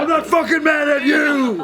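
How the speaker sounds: slow, high, shouted imitation; mock scream. Friend mimics him screaming at girlfriend, mock shout. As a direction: shriek it back at him in mockery. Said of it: "He said while screaming".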